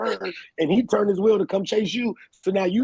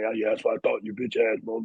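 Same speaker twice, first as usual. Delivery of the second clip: low mock trash talk in retelling; 7 semitones lower than usual. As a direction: imitate a man talking trash as he leaves. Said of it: "he was popping shit on the way".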